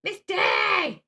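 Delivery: loud high shout of the name; calling out. Real, not acted. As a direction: holler it across the room.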